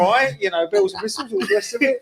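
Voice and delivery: a jokey London Cockney voice; exaggerated Cockney. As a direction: ham up a Cockney geezer accent. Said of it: "That's proper Cockney".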